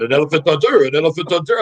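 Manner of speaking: imitated French accent on the quote. Teammate announcing his career after hockey, imitated French accent. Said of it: "He's saying in his French accent".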